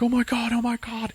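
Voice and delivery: hammed-up frantic alarm; mock panic. Doctor panicking as the patient is electrocuted, high mock panic.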